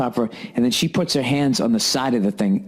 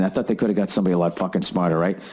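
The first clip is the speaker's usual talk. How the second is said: ordinary delivery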